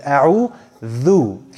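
أعوذ said slowly, tongue between teeth for the dh; correct Arabic sound. Shown as a demonstration. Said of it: "your tongue is on the outside of your teeth".

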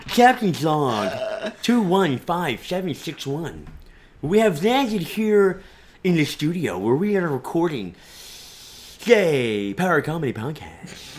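slow, halting Captain Kirk log voice; a hammed-up starship captain's log; mock Kirk reading the captain's log, laughing; Shatner impression. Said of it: "great American actor William Shatner".

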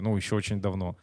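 ordinary talk, nothing marked

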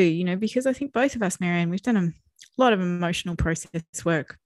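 ordinary talk, nothing marked

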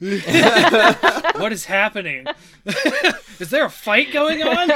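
high, loud startled bystander, laughing; hammed-up, high-pitched alarm; mock alarm. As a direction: play a panicked eavesdropper through laughter. Voicing alarmed people hearing boss music.

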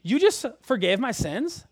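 thrilled prophet volunteering; eager and grateful. Pretend. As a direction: build up until you burst with joy. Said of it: "I'm picturing Isaiah being like".

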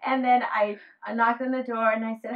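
usual speaking voice

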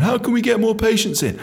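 a hint of a pestering manager; imitated boss. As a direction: pitch it up as a mock boss asking.

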